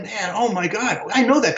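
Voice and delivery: rising amazed recognition; freaking out. Retold or imitated. Recognizing the saxophonist on stage, freaking out. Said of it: "I was freaking out".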